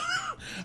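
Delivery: a short high giggle; high squeaky laugh. Real, not acted. Comedian laughing at his own outrage, high squeak. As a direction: let a squeaky laugh slip out.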